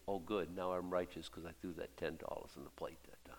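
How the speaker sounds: soft whisper, self-satisfied; whispered smug thought. Retold or imitated. Imagined giver congratulating himself, whispered.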